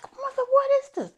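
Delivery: put-on very high, fast, shocked child's cry. Daughter shocked at her mother's outburst, high and fast. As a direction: squeal it out in disbelief. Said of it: "And I'm like".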